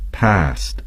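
American English past, with a flat a as in cat; American pronunciation of past. Shown as a demonstration. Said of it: "and Americans would say".

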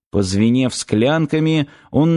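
usual speaking voice

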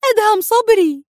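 wife blurts a name to her husband; quick wife. A narrator's reading. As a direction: answer fast and urgently. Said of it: "أجابته بسرعة".

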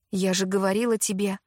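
usual speaking voice

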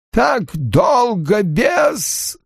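high, very slow eerie murmur; Aginor. A narrator's reading. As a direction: drag it out like an ancient ghost. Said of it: "тихо произнёс Агинор".

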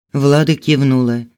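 usual speaking voice

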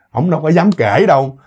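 ordinary delivery

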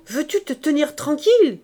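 high, loud telling-off; scolding shout. Acted. Gervaise scolds Augustine for poking the filter, loud. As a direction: snap at the child. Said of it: "cria Gervaise".

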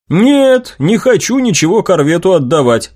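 high-pitched, instant refusal; bristling objection. Acted. Inventor refusing to give his idea to Corvette, bristling. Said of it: "вскинулся я".

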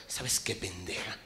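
whispered ¿sabes qué? with pent-up rage; too angry to speak aloud; seething hush; furious whisper. Retold or imitated. Enraged man hisses at wife.

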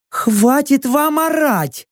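high, drawn-out fed-up cry; exasperated yell. A narrator's reading. Kassandra fed up with the shouting, exasperated yell. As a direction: wail it as if patience has snapped. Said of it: "завопила не выдержавшая Кассандра".

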